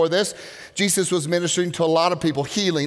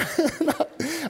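laugh; 8 semitones higher, 5 dB quieter than usual. Real, not acted. Laughing at the memory.

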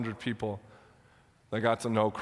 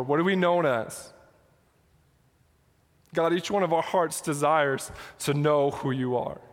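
earnest pastor's prayer, pitched higher; 4 semitones higher than usual. Acted. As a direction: lead the room in heartfelt prayer. Pastor leads congregation in prayer.

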